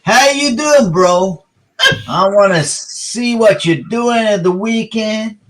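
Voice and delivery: put-on slow, over-the-top American accent come-on. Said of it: "best American accent".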